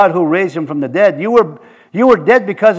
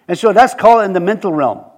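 ordinary talk, nothing marked

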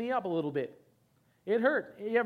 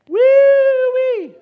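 high, very loud celebratory whoop; joyful woo-wee shout; 18 dB louder than usual. Real, not acted. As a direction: let out a whoop of joy.

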